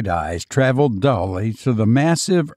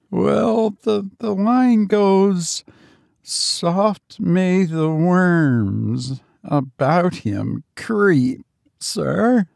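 put-on high, slow old houseman's voice; drawn-out, uneasy recitation by an old servant; reluctant old servant; 7 semitones higher, much slower than usual. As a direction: recite it slowly and haltingly, pitched up.

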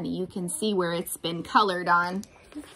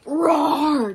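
loud, higher-pitched storybook roar; dragon roar; 5 semitones higher, 6 dB louder than usual. Acted. Reader voicing the dragon fighting the knights, loud roar.

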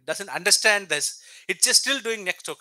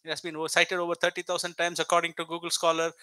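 ordinary delivery

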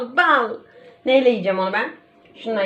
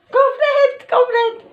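excited; 13 semitones higher, 4 dB louder, slower than usual. Real, not acted. Excited call of wafer result.

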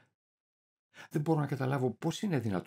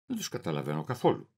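ordinary delivery, nothing marked

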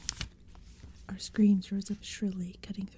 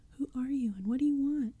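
frightened, higher voice; high, tiny voice; 6 semitones higher, 4 dB louder than usual. Acted. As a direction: call out, scared and small. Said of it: "My voice came out high and tiny".

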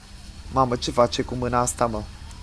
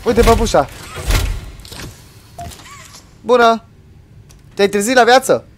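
excited greeting; 10 semitones higher, 6 dB louder than usual. Real, not acted. Greets doll cheerfully.

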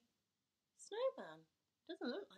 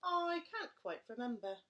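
gruff father; 3 semitones higher, 7 dB louder than usual. Acted. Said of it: "Gruffalo father answers".

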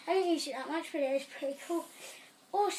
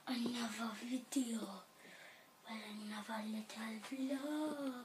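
soft unvoiced whisper; 4 semitones lower, 9 dB quieter than usual. Real, not acted. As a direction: drop to a whisper. Whispered aside in intro.

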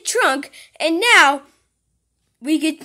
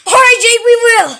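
louder, raised reply; loud shout; 6 semitones higher, 8 dB louder than usual. Acted. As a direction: shout the answer back. Answering a yell loudly.

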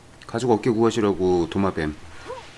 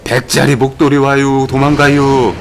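urgent warning; 3 semitones higher, 8 dB louder, slower than usual. Real, not acted. Warning as the creature approaches.

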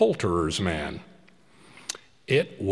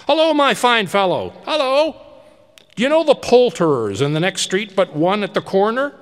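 put-on high, loud giddy old man; elated calling out; 10 semitones higher, 7 dB louder than usual. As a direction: call down to the street overjoyed. Scrooge hails the boy.

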